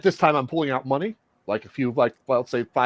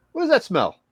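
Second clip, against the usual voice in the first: high questioning; 10 semitones higher than usual. Acted. Player in character questioning the odd smell, high pitch. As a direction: pitch jumps up on "What does that smell?". Repeated line louder pitch.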